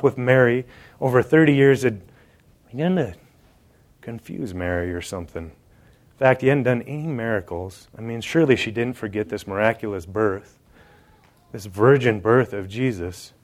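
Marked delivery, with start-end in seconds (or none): none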